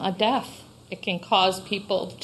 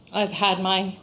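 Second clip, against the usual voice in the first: usual speaking voice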